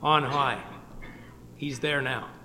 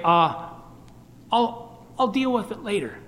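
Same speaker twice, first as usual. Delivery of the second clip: higher, mildly mimicked procrastinator; casual putting-off; 4 semitones higher than usual. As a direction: lightly imitate someone putting it off. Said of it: "have you ever heard people say".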